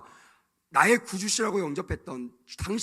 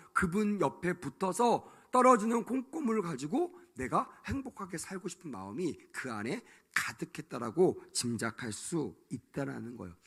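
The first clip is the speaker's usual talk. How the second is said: usual speaking voice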